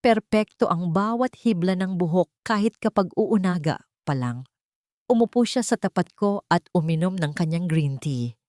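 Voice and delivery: usual speaking voice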